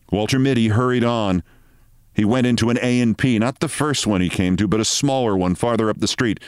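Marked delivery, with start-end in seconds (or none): none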